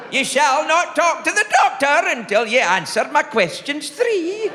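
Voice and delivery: high, theatrical witch decree. Retold or imitated. Receptionist setting questions to a caller, witchy pronouncement. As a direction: intone it like a riddling witch. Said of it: "she's probably a witch".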